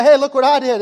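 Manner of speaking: loud, high, bragging call; show-off excitement. Retold or imitated. Husband brags to his wife about the dishes, loud and eager. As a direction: shout it like a proud kid wanting praise. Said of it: "You wanna say".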